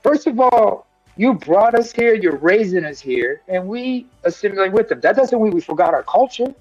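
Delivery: ordinary talk, nothing marked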